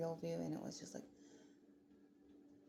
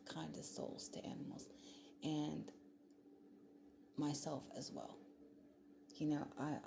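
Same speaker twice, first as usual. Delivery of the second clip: usual speaking voice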